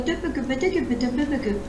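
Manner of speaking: rapid "que, que, que" imitating French sound; imitating French to foreigners. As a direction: chant "que" over and over. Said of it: "ce qu'ils entendent".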